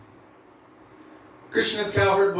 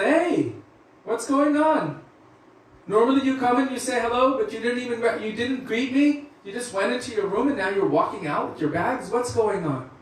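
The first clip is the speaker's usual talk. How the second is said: much higher worried mother voice, quick at first; 8 semitones higher than usual. Retold or imitated. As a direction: Yashoda asking her son why he's leaving with his bags, concerned and higher. Mother Yashoda questions leaving son.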